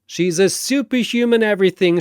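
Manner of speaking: usual speaking voice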